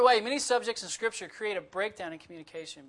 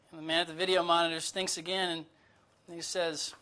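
usual speaking voice

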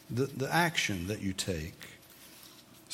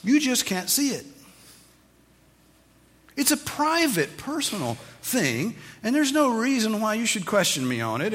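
mocking a skeptic; 9 semitones higher than usual. Preacher mimicking people who claim private faith, mock high voice. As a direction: parody the defensive claim. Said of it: "what people sometimes try to claim".

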